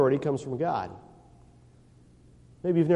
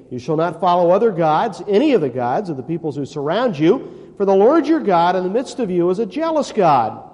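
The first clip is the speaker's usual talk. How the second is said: fiery scripture reading; preaching scripture; 5 dB louder than usual. Acted. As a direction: thunder the verses at the congregation. Preaching scripture at full force.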